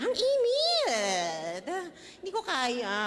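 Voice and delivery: playful anguished moan; mock wail from hell. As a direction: ham up suffering in the heat. Imagining herself burning in hell.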